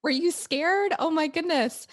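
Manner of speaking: playful imitation of a worried gasp; alarmed mother. Host retelling her own worried question to the son, mock alarmed. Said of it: "And you're like".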